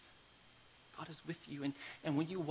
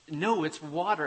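insistent claim; 3 semitones higher, 12 dB louder than usual. Retold or imitated. Man insists on mirage.

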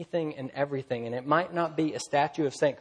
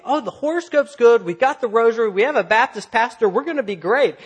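high, loud, gushing imitation; mock delighted lady; 7 semitones higher, 8 dB louder than usual. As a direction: mimic a traveller thrilled by every good omen. Mimics lady thrilled by good omens.

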